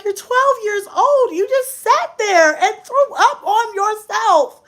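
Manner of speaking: slow, high-pitched wail of disbelief; hammed-up tearful outrage; exasperated, near tears. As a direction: stretch out the scolding, almost crying. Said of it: "I was ready to cry".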